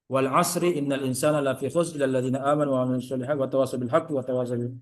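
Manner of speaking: quick, steady recitation of the surah; closing recitation of Al-Asr; Quran recitation. Acted. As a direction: recite Al-Asr together, briskly. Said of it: "sama-sama membaca surah Al-Asr".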